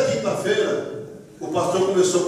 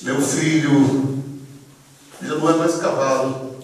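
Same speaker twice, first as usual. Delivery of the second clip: low, slow, solemn reassurance; imitating a pastor's tender declaration; fatherly pronouncement; 6 semitones lower, slower than usual. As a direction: declare it gently, stretching the address. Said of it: "o pastor olha pra mim e diz assim".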